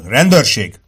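loud announcement of authority; police shout. A narrator's reading. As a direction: call out loudly as an arresting officer. Police officer challenging a suspect. (hangos rendőri felszólítás)

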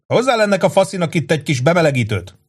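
rowdy barroom buddy; boisterous Angelo. A narrator's reading. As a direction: hail a friend across a packed bar. Angelo voiced.

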